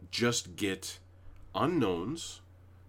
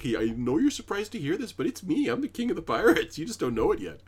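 higher, a bit faster, breezy confidence; cocky certainty; 8 semitones higher, 5 dB louder, faster than usual. Retold or imitated. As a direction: tell them as if it is obvious news. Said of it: "he's saying it like".

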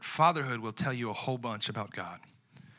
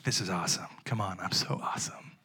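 whispered self-praise; 7 semitones lower, 6 dB quieter than usual. Retold or imitated. New father whispering over a sleeping baby. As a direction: lower whispered delight.

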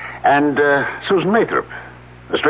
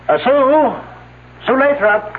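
much higher call across to the next shed; much slower than usual. Acted. Professor summoning student from the specimen shed, high calling voice. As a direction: raise your voice to reach next door.

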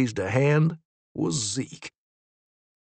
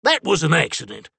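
shouted; 3 semitones higher, 4 dB louder, faster than usual. A narrator's reading. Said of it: "Zeke shouted".

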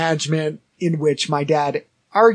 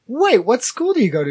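high-pitched puzzled question; retold surprise; 8 semitones higher than usual. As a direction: replay your astonished double take. Retold astonished question to classmate.

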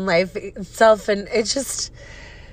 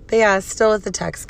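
ordinary talk, nothing marked

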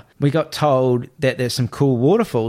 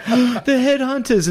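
mock terrified scream; 11 semitones higher, slower than usual. Village kids running from the car, imitated terrified scream. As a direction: wail it like a child fleeing in terror. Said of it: "and screaming and running away".

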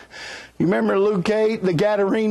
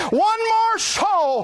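tearful pastor; 12 semitones higher, slower than usual. Retold or imitated. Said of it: "he said".

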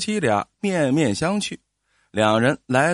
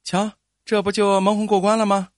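higher-pitched, smug wife; 6 semitones higher than usual. A narrator's reading. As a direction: crow lightly over getting away with it. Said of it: "老婆得意地说". (得意洋洋地说)

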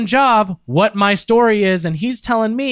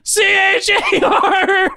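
laughing shout; 10 semitones higher, much slower than usual. Real, not acted. Spelling while cheeks pinched.

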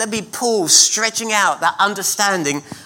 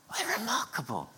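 a soft, wondering whisper to yourself; awed whisper; 4 semitones lower, 13 dB quieter than usual. Real, not acted. Preacher marvels at Jesus' promise, whispering. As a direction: breathe it out in a hushed whisper.

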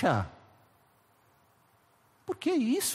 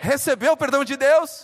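higher and louder, calling out to the crowd; loud rousing call; 7 semitones higher, 7 dB louder than usual. Real, not acted. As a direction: shout the question to the church. Preacher demands a loud amen.

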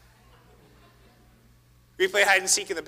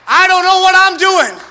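pleading prayer; 12 semitones higher, 13 dB louder, slower than usual. Acted. Honest cry for help.

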